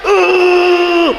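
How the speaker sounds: high, held "uuh" of mock anger; angry growl demo. Shown as a demonstration. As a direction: strain out a long angry noise. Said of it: "kalau marah-marah gitu". (geraman marah pura-pura, nada tinggi)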